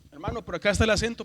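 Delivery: usual speaking voice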